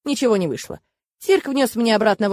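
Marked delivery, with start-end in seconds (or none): none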